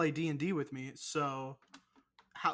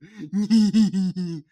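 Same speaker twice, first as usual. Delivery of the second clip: loud burst of real laughter; 3 semitones higher, 14 dB louder than usual. Real, not acted. Laughing at his own Texas impression, loud laugh. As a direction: crack up at your own bit.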